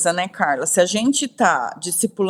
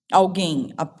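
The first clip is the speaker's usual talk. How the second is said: ordinary talk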